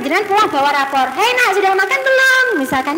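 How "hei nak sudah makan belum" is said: high, bright friendly welcome; welcoming a child home with a report card; cheerful greeting. Shown as a demonstration. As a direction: greet the child happily first. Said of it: "Pulang-pulang tanya dulu".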